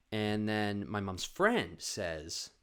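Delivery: ordinary delivery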